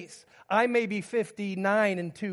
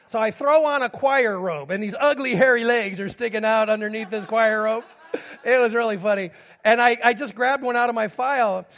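much louder, amused telling; excited storytelling; 4 semitones higher, 10 dB louder than usual. Real, not acted. Preacher telling his congregation a funny wedding mishap, loud and amused. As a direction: build the comic story with energy.

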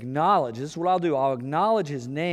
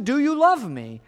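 pleading spouse; 10 semitones higher, 6 dB louder than usual. Retold or imitated. Spouse repeats the question.

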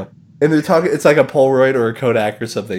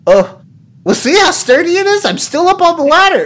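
much higher, louder strained pitch; forced cheerful salesman; 5 dB louder than usual. Retold or imitated. Imitating the host tilted on the broken ladder, mock brave. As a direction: keep selling though it's broken. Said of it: "he's like".

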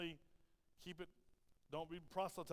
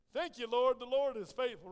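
loud, raised-voice thanksgiving; jubilant praise; 5 semitones higher, 10 dB louder than usual. Retold or imitated. Teacher thanks the Lord for the boardroom, jubilant praise. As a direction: exult to the heavens. Exults on seeing the executive boardroom.

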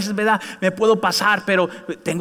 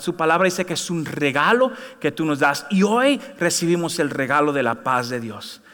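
prayer; 4 semitones lower than usual. Acted. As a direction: pray gently, lowering to a hush. Leads congregation prayer.

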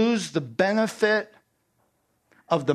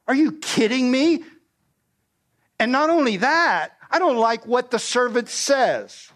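furious Naaman; 8 semitones higher than usual. Acted. Naaman, snubbed by Elisha's servant, bursts out high and indignant. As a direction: let the insult boil over.

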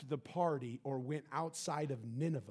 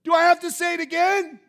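much higher and louder, hammed-up scolding of son; exasperated parent yell; 19 dB louder than usual. Preacher imitating himself scolding his son, exasperated yell. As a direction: blow up at the kid, half-comic. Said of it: "this is what I do. I go".

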